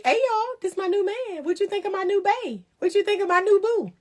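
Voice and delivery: high, giddy show-off voice; gushing post. Pretend. Announcing her new man to her followers, giddy and high. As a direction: squeal it like showing off your new man.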